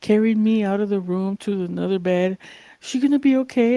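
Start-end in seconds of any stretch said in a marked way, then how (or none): 2.8-3.8 high, louder worried question, imitated; concerned stripper. Stripper asking about drunk young guest, acted concern. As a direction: act out an anxious onlooker asking.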